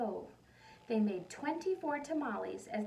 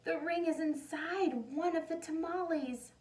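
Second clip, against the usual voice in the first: anxious realization; 7 semitones higher than usual. Acted. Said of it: "she thought to herself".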